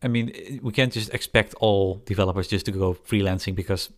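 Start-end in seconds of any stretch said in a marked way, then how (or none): none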